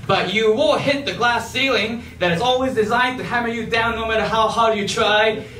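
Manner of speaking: ordinary talk, nothing marked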